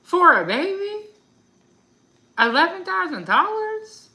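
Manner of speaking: incredulous. Real, not acted. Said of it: "it sounded crazy".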